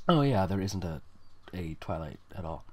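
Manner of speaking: ordinary talk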